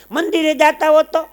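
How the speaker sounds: high-pitched nagging housewife act; put-on female voice, pitched well up; daughter-in-law. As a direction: shoo the elder off in a high voice. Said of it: "તો વહુ દીકરી શું કે".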